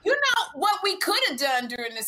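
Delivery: high-pitched burst of a new idea; excited, swooping high pitch; loud, excited. Real, not acted. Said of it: "Why are you so loud?".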